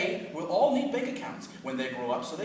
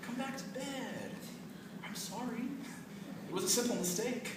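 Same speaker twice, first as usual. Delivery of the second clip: soft, slow sweet-talking; charming; 10 dB quieter than usual. Acted. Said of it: "turning on the charm".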